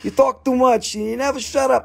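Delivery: high-pitched teasing scold; playful put-on nagging, pitched high; imitated mockery. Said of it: "you're gonna make fun of me".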